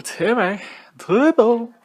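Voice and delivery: high, loud sing-song name call; hyped-up high shout of the name; excited call. Pretend. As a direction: announce the name like a hype man.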